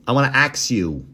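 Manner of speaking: imitated "ax", ks in place of sk; ax for ask. Host mocks the question to the witness, AX for ask. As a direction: say AX like the tool, meaning ask. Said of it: "or is he saying ax".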